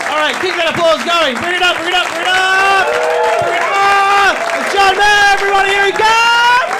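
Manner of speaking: loud, high announcer shout over applause; announcer hype. Real, not acted. MC rousing the audience for the next comic, loud announcer. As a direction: pump the crowd up like an MC. MC hyping the crowd.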